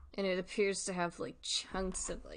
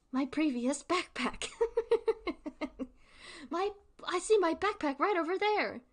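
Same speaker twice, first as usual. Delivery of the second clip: high put-on little kid voice, a bit louder; whiny kid; 9 semitones higher, 3 dB louder than usual. Kid begging the villain for his backpack, high whiny voice. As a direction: plead like a small child spotting his backpack. Child pleading for backpack in skit.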